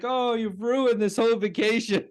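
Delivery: high mock Homer complaint; quoting Homer, ruined vacation; mock Homer whine. Homer quoted whining at Flanders.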